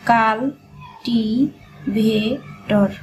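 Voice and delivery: কাল, টি, ভে, টর split into separate beats with pauses; dictating each syllable slowly. Shown as a demonstration. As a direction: break কাল টি ভে টর apart, one syllable at a time. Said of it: "এভাবে ভেঙে ভেঙে লিখবেন".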